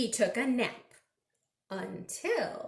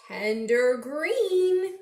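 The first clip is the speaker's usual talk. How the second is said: slow, louder drawn-out clue; slow teasing; 3 semitones higher, 6 dB louder, slower than usual. Acted. Reading a clue to kids, slow and teasing. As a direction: stretch it to build suspense.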